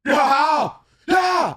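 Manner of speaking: very high, loud gleeful yell; excited yelling. Retold or imitated. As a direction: yell it out, totally pumped. Retelling his pumped reaction.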